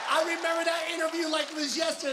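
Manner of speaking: high-pitched, gleeful shouting; excited outburst. Retold or imitated. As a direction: burst out high with proud excitement. Excited fan relives hearing Manny's accent.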